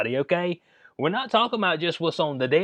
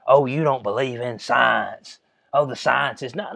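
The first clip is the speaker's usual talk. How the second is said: lower, slow, sneering mock voice; mocking the science crowd; 6 semitones lower, slower than usual. Vlogger mocking people who say trust the science, in a slow sneering voice. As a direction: drag it out like a smug preacher. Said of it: "these are the same people that tell you about the science".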